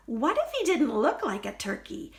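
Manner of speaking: ordinary delivery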